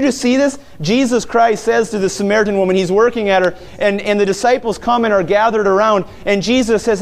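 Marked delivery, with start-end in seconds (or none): none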